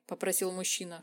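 usual speaking voice

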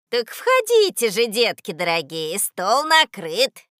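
sing-song with drawn-out ending; coaxing old woman. A narrator's reading. Old woman coaxing children to her table, sing-song. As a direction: invite with syrupy insistence.

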